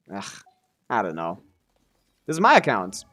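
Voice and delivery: ordinary talk, nothing marked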